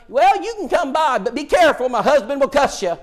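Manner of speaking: a country lady cautioning the preacher; Phyllis's voice. Retold or imitated. Preacher voices Phyllis warning him.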